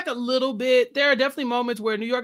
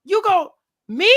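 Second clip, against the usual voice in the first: high, loud indignant disbelief; mock incensed; 7 semitones higher, 6 dB louder, slower than usual. New York reacts to being challenged on eliminations, mock incensed. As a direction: imitate someone affronted, pitched up and punched. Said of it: "New York is incensed".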